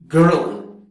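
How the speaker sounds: ordinary talk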